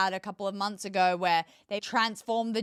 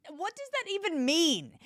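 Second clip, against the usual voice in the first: incredulous; 7 semitones higher than usual. Real, not acted. Incredulous rhetorical question.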